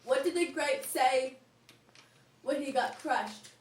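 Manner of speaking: normal talking voice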